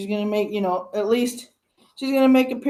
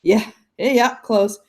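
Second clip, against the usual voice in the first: usual speaking voice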